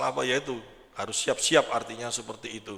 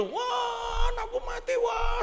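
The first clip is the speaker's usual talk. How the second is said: wailing parent; much slower than usual. Acted. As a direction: cry out like a mother who lost her son. Neighbour wailing over dead son. (ratapan orang tua yang anaknya mati, suara tinggi)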